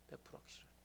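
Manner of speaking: 백 프로 확실하니까 whispered with no voice; whispered repeat. Shown as a demonstration. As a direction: drop to a hushed whisper.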